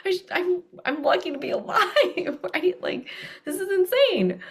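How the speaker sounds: high, incredulous and laughing; high laughing disbelief. Retold or imitated. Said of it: "I was like".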